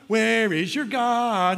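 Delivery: high, drawn-out mocking jeer; taunting. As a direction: stretch it out like a playground taunt.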